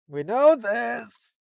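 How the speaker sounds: high, loud, very slow whine; exasperated shout. Real, not acted. Annoyed at the story's recap, a long high shout. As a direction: stretch it out in disbelief.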